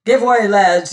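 loud order to the crew; crying out. A narrator's reading. Adam orders his rowers off, crying out. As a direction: call out to the oarsmen. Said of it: "he cried".

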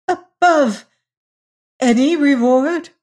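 high, loud incredulous question; stammered disbelief. A narrator's reading. Lady wondering if she heard Hagar aright, high stammered disbelief. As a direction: echo it as if you can't believe your ears. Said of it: "stammered the other, wondering if she heard aright".

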